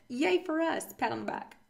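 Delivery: dry mock cheer; deadpan fake celebration; sarcastic. Real, not acted. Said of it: "that was very sarcastic".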